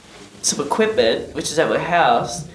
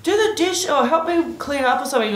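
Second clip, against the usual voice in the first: high nagging mom voice; mock a mother nagging about chores; mother nagging her daughter to do the dishes, high mock nag; 10 semitones higher than usual. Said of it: "her mom's like".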